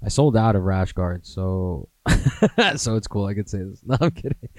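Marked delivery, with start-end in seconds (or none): 2.0-2.7 high-pitched burst of laughter. Real, not acted. Podcaster cracking up after selling out of rash guards, real laugh. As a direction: laugh out high at your own joke.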